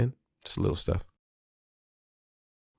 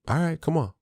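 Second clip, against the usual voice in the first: willing parent; 9 semitones higher than usual. Retold or imitated. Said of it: "Try the".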